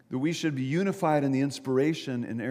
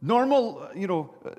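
higher, louder called-out word; mock yell down; 5 semitones higher, 4 dB louder than usual. Jumper fumbling his answer to bystander, mock yelling. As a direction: yell it down, acted. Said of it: "the man yelled down".

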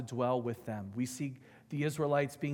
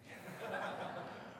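soft breathy laugh; 7 semitones higher, 11 dB quieter than usual. Real, not acted. Laugh after God's rebuke.

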